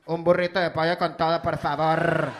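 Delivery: loud, full Spanish order; loud fluent Spanish. Retold or imitated. The cashier switching to Spanish, loud and fluent. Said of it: "She was like".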